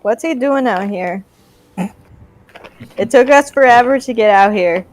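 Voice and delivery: normal talking voice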